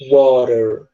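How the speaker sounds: American "water", R-like T and sounded final R. Shown as a demonstration. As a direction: say "water" as Americans do, T turned into an R tap. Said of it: "como se fosse um R".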